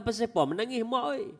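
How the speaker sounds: a hint of a worried husband; husband asking. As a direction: ask the weeping wife gently why. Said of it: "Abu Darda kata".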